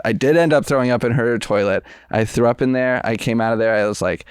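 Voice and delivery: normal talking voice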